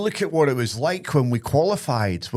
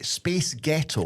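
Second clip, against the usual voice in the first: demonstrating; 3 dB quieter, much slower than usual. Shown as a demonstration. Podcast host setting up an accent trick for co-hosts, demonstrating slowly.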